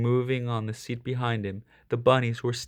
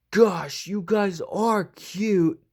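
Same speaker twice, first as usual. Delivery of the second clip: 1 gushing over cuteness; 7 semitones higher, 3 dB louder, much slower than usual. A narrator's reading. Mike melting at cute bunnies.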